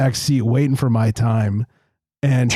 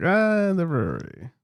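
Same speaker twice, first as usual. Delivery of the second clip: unimpressed; 5 semitones higher, 4 dB quieter than usual. Retold or imitated. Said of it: "And it's like".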